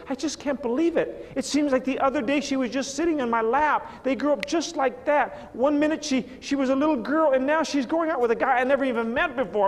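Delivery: a touch of tearful disbelief; wistful father. Acted. Father telling his wife his little girl grew up, a touch tearful. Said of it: "He goes".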